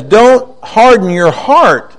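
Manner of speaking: slow, weighty DON'T HARDEN YOUR HEART; solemn scripture warning, word by word; emphatic quote. Retold or imitated. Quoting Psalm emphatically to congregation.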